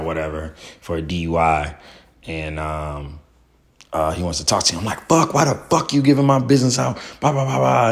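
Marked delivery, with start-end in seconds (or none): 5.1-7.9 high, loud, exasperated yelling; retold angry yell. Yelling at Phil for giving out his business, angry. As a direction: snap at him, fed up and stressed. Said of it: "I'm yelling at Phil".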